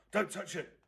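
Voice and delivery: ordinary delivery, nothing marked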